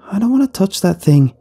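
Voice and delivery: put-on high, disgusted girl; Carly's voice. A narrator's reading. Carly to the teacher, high and disgusted. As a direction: recoil as you say it. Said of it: "Carly said".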